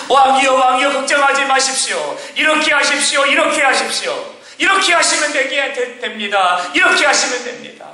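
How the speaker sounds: high, pressing voices of royal advisers; a touch of hammed-up pleading to a king; urging courtiers. Courtiers lining up before the sick king, urging him insistently.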